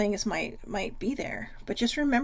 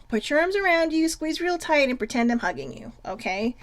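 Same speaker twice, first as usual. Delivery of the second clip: ordinary talk, nothing marked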